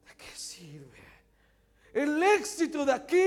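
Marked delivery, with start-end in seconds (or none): 0.0-1.0 breathy unvoiced whisper. Shown as a demonstration. Preacher to the congregation, sudden whisper. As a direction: drop to a bare whisper. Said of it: "Susurro es hablar palabras sin voz".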